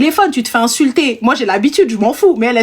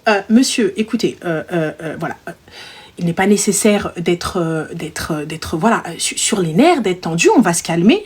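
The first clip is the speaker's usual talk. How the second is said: low, halting, forced politeness; restrained, teeth clenched; 6 semitones lower than usual. Retold or imitated. Agent tells tense client to calm down, tightly restrained. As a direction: hold back rising tension, hesitating over words. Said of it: "Et là, je serre mes dents".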